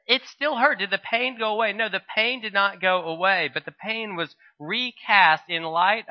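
preacher pressing a point with rising fervour; fervent preaching. Real, not acted. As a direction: drive the question home from the pulpit. Preacher rises to emphatic point.

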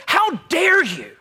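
retold angry yell. Mister Chan scolds the man he saved, a high furious shout. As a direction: yell it like a rescuer scolding a would-be jumper. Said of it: "He starts yelling at the person".

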